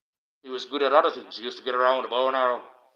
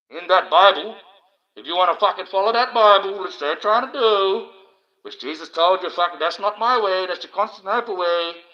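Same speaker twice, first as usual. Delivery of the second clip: higher and louder, preacher-style rant; ranting shout; 5 semitones higher, 6 dB louder than usual. Real, not acted. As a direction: hammer the Bible point home. Ranting about Jesus and the Bible.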